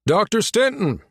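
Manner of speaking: high-pitched call over the din; shout. A narrator's reading. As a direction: call out to be heard over noise. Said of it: "he shouted".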